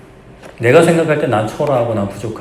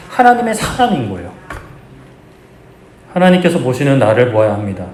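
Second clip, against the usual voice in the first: ordinary delivery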